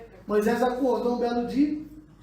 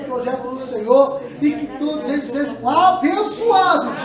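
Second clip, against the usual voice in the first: loud, rising preacher's prayer; blessing the church in a loud, stretched prayer; fervent prayer; 3 semitones higher, 6 dB louder than usual. Acted. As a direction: pray over them with full force, building. Pastor prays over congregation.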